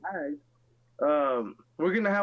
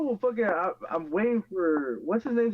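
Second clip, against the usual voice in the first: ordinary talk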